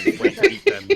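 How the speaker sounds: excited shout. Real, not acted. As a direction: blurt it out laughing.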